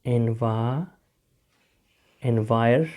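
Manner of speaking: એન્વા- then એન્વાયર, with a long pause between; building in parts. Shown as a demonstration. Teacher builds a hard word for learners, part by part. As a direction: stop partway, then add the next sound to એન્વાયર.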